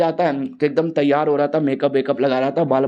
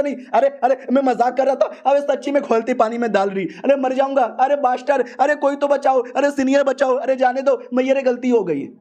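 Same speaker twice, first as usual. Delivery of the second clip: high, frantic begging to be spared; panicked pleading; 7 semitones higher than usual. Acted. Disciple begs the leader not to boil him, frantic high pleading. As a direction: squeal for mercy like a scared kid. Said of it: "बेचारा कैसे बच्चों की तरह रो रहा है।".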